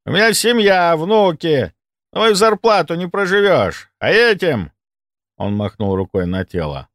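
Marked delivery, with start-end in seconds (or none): 0.1-4.7 high, pleading self-justification; defensive thief making excuses; cornered, indignant orderly. Acted. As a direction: argue back in a raised, aggrieved pitch.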